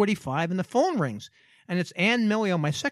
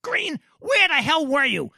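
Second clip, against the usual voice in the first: boss yelling; 7 semitones higher, 3 dB louder than usual. Retold or imitated. Boss catching his PR guy late, yelling. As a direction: bark at the late employee.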